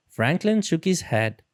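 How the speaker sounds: ordinary delivery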